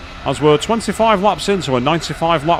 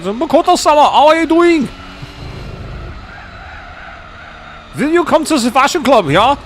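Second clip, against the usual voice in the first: put-on playful, much higher German-accented voice; 12 semitones higher than usual. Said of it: "I started doing a German accent".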